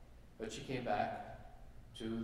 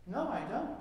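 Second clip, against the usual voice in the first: lightly voiced lady, pitched higher; higher-pitched joke-character answer; young lady replying; 9 semitones higher than usual. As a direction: lift the voice for the lady's short reply.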